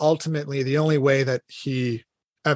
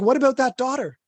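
high, quick questioning; voiced onlooker's protest; puzzled objection; 11 semitones higher than usual. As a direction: jump in with a raised-pitch challenge. Voicing objection to the story.

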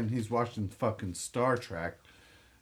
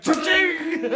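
high, loud laughing cha-ching; cash register cha-ching; 8 dB louder than usual. Retold or imitated. Friend mimics the register hitting his brother's head, a loud high laughing cha-ching. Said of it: "It was like".

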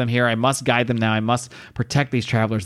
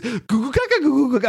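much higher, babbling goo goo gaga, laughing through it; mock baby talk; 11 semitones higher than usual. Host mocking how French sounds, in high laughing baby babble. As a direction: mimic French as a cooing baby. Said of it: "it always sounds like baby talk to me".

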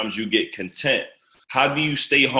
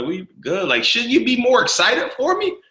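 puzzled disbelief; 10 semitones higher than usual. Retold or imitated. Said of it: "I'm like".

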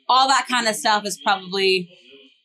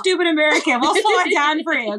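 mock patronising; 6 semitones higher, faster than usual. Voicing locals slowing down for Americans.

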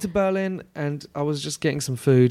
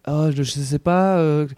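French local; much slower than usual. Retold or imitated. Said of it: "they'll be like".

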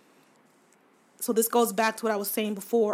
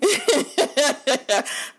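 bright burst of laughter; laughing; 5 semitones higher, 4 dB louder than usual. Real, not acted. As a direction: laugh it off lightly. Laughs at own phrasing.

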